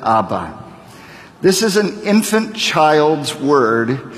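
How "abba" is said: Abba low and soft, AH-buh falling; repeat-after-me Abba. Shown as a demonstration. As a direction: model Abba for them to echo. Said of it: "Can you say that?".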